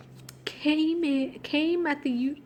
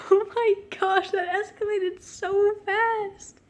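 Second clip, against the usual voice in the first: ordinary delivery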